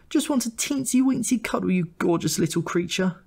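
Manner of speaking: high, cooing auntie voice; cooing Lucy. A narrator's reading. Lucy coos to the little brother, high and sugary. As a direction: coo it out sweetly and sickly. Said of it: "she'd coo".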